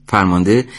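usual speaking voice

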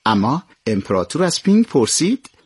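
plain reading voice

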